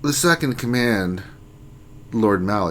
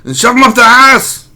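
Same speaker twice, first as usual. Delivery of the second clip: fast Austrian rant building to a yell; ranting mock Austrian; 3 semitones higher, 6 dB louder, faster than usual. Streamer scolds interrupting co-host, mock Austrian rant building loud. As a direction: build to a hammed-up Austrian bellow.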